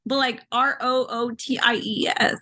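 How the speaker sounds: whispered letters R O O T I E S; spelled out. Shown as a demonstration. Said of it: "spelling it out".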